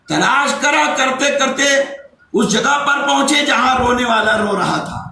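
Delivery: high, ringing sermon narration; impassioned preaching. Acted. Preacher telling of the search for the crying child, impassioned.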